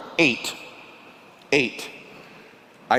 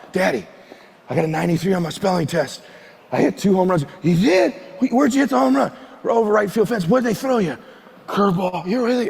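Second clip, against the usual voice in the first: higher-pitched, lightly acted boy-and-dad exchange; eager chat; 7 semitones higher than usual. Boy telling his drinking dad about home runs, eager chat. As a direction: play an eager child and an interested dad.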